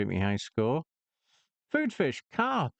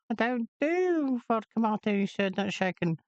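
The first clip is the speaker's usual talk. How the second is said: much higher put-on barman voice; high, huffy barman reply; shrieking bar owner; indignant barman; 9 semitones higher than usual. Shown as a demonstration. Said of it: "that thing that I just said about the martini".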